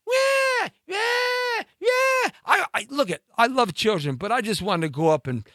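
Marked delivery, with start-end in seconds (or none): 0.1-2.3 very high mock crying wah wah; imitated kid crying. Mocking the screaming kid in the restaurant with high wails. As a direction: wail like a bratty kid, shouting the last wah. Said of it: "So this kid's crying".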